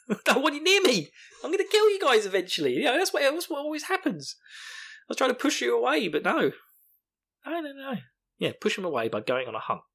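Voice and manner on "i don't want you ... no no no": squeaky, high-pitched overwrought rant; mock frantic outburst. Host as Rand pushing friends away, mock frantic. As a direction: whine it out in hammed-up high voice.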